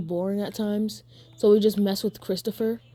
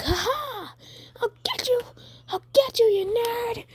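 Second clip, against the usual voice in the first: very high, squeaky mock-villain gloat; gloating Bakugo. Storyteller playing Bakugo springing his trap on Deku, gloating. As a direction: cackle in triumph in a high put-on voice.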